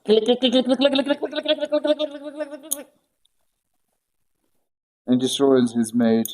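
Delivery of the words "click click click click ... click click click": much higher clicks, fading to nearly nothing; machine-gun the clicks up high, then let them die away; imitating the mic clicks ruining a listener's calm; mock click attack. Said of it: "Then you just hear".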